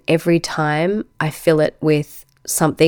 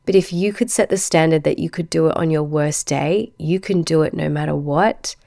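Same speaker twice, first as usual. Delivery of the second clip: ordinary delivery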